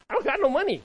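loud, high-pitched brush-off to a stranger; hammed-up New York street refusal; suspicious New Yorker. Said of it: "people are like".

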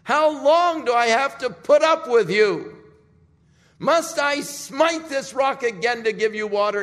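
loud, high, furious yell at the people; angry shout. Acted. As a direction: rage at them like a fed-up leader. Moses angrily strikes the rock.

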